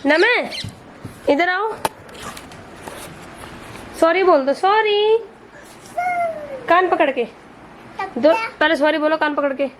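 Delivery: high, singsong coaxing of a bird; coaxing a pet. Real, not acted. To her parrot Name, high coaxing baby talk. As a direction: coax the parrot to repeat after her.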